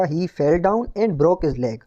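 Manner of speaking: very slow, careful reading with fell; slow corrected line. Shown as a demonstration. Teacher dictates the corrected sentence slowly to class. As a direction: dictate it slowly so students copy it. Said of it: "correct version होगा".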